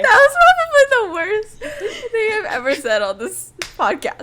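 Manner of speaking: high, loud laughing. Real, not acted. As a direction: laugh all through it. Laughs at her own pun.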